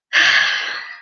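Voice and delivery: long tired breath out; exhausted sigh before going on; weary sigh. Real, not acted. Tired after long reading session.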